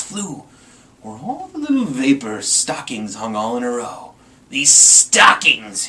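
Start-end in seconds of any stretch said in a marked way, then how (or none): 4.5-5.9 slow, very loud drawn-out gloat; grinning Grinch. Acted. Grinch eyeing the stockings, slow wicked gloat. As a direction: savour each word wickedly. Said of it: "he grinned".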